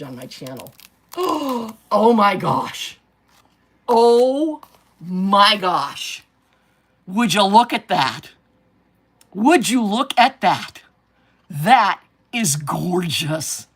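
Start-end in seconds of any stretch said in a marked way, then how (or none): 1.1-1.9 sharp delighted gasp; surprised gasp. Real, not acted. Sees craft result. 1.9-13.7 slow, loud, drawn-out wonder; awed amazement. Real, not acted. Amazed at craft result.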